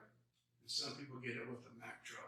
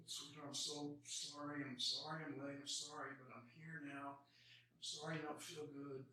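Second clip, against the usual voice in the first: tender apology; 3 semitones higher, 7 dB quieter than usual. Retold or imitated. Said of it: "He says".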